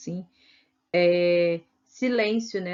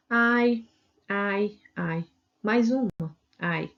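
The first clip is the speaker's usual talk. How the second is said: lightly acted ouch cries; pained ai demo; much slower than usual. Shown as a demonstration. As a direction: act out a string of small hurts. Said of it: "esse ai, né, também tá explicando a questão dos sentimentos".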